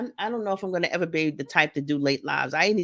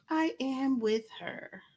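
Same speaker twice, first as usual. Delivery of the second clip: softer, slow repeat to herself; soft echo; 5 semitones higher, 9 dB quieter, slower than usual. Real, not acted. Repeating her agreement to viewers, soft and trailing. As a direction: let it fall away quietly.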